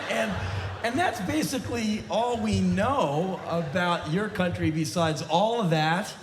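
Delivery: normal talking voice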